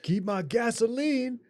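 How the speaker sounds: high, stretched-out parody cry; mock outraged yell. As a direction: draw it out high like a hammed-up threat. Riffing on the same shouted line.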